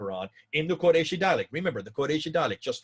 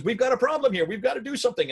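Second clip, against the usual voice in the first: high-pitched, louder alarmed plea; urgent appeal; 11 semitones higher, 5 dB louder, faster than usual. Retold or imitated. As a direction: sound the alarm, pitched well up. Hudayfa's urgent appeal to Uthman, retold.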